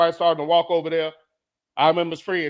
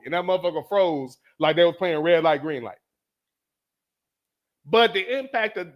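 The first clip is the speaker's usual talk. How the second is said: ordinary talk, nothing marked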